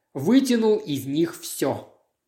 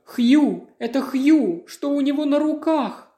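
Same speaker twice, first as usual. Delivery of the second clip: higher, louder rising alarm; horrified cry; 9 semitones higher, 3 dB louder than usual. A narrator's reading. Diana seeing Hugh's hands, crying out in rising horror.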